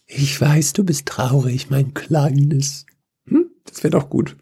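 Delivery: half-hearted soothing mum talking to her little one; a mild hint of a tender mother comforting her child; loving mother. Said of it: "dann lese ich das als, äh, liebende Mutter".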